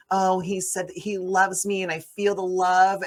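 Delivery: lightly voiced, mooning mother reading her diary; a hint of a lovestruck mom; dreamy diary voiceover. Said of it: "the diary voiceover is like".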